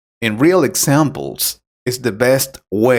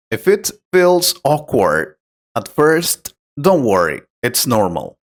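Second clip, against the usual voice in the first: ordinary delivery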